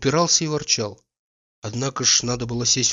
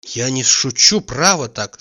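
ordinary delivery

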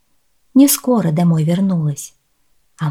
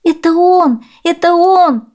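high, loud outburst; high-pitched distraught little girl; girl exclaiming; 11 semitones higher, 6 dB louder, slower than usual. A narrator's reading. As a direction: cry out in recognition. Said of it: "воскликнула девочка и заплакала".

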